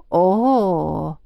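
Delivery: long sarcastic "oh"; mock-impressed, lingering vowel; drawn-out sarcasm. A narrator's reading. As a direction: draw the sound out with open sarcasm. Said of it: "протянул Тай, даже не пытаясь скрыть сквозящего в голосе сарказма".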